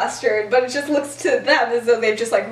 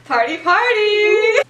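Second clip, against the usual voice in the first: excited cheer; 9 semitones higher, 4 dB louder, slower than usual. Real, not acted. Cheering the couple's kiss.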